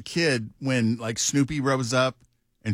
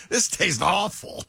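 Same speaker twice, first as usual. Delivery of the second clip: mock disgust; 8 semitones higher than usual. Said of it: "he'd be like".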